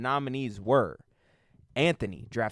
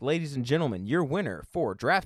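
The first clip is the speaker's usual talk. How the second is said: booming pumped announcer; 5 semitones higher, 3 dB louder than usual. Acted. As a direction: announce the winner like a gala host. Host announcing award winner.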